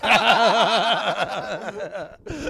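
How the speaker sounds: long burst of laughter; loud laugh. Real, not acted. Laughs at own punchline.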